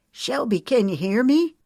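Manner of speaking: put-on calling out to an unconscious girl, loud and urgent; yelled. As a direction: shout his name to wake her. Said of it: "he yelled as he shook her".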